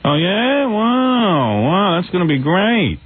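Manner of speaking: high, drawn-out mock gushing; Rosie O'Donnell. Imitated host wowed by guest's movie, high mock gushing. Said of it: "she says".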